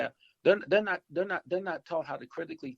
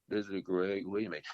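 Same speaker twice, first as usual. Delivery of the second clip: lower, deflated phone greeting from an upset receptionist; 7 semitones lower than usual. Retold or imitated. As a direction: answer the phone like someone who was just scolded, dropping the pitch. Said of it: "And, but she's gonna say".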